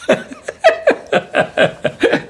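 short high mocking chuckle; high mocking laugh. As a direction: laugh down at him. Detective gloats.

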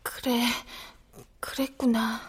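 soft whispered acceptance; child quietly whispering acceptance of death; resigned whisper. Acted. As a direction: let it out on a breath.

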